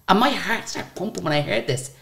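ordinary talk

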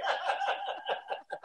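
high airless wheeze of a laugh; helpless silent cackle; wheezing laugh after being pranked; breathy laugh. Real, not acted.

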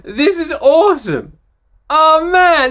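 high, moved and excited; overjoyed. Real, not acted. Moved by the fans song, high overjoyed gushing. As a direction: gush with real delight, close to tears. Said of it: "I am a little bit teary".

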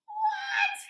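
very high, loud squawked question; outraged disbelief. Acted. Pigeon told a puppy isn't watered, outraged disbelief. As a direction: cry out as if deeply affronted. Pigeon reacts to being called silly.

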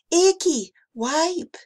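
put-on high, loud little-girl voice; gleeful child. A narrator's reading. Emma wiping the monster's noses, gleeful child voice. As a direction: play a delighted small child, pitched high.

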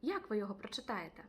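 ordinary talk

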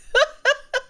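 very high, loud burst of laughter; squealing real laugh. Real, not acted. Storyteller enjoying her own video, squealing laugh. Laughing fit while recording.

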